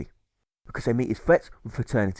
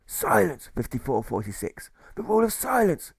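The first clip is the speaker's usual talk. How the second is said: huffing pirate jailer shouting silence; 5 semitones higher than usual. Acted. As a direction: huff out the rule. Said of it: "The jailer huffs and puffs".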